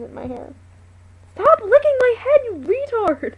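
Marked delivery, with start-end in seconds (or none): 1.3-3.3 much higher, much louder scolding shriek; shrieking yell. Real, not acted. Owner shrieks at the dog licking her head.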